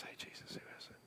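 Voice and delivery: confidential whisper into an ear. Acted. John quietly asking Jesus who it is, whispering. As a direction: whisper it very softly, for him alone. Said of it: "he kinda whispers to Jesus".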